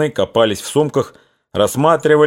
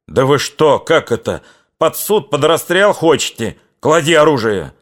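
yelling; 6 semitones higher than usual. Acted. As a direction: shout them down before they kill each other. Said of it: "заорал урядник".

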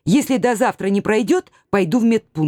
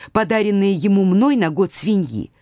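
usual speaking voice

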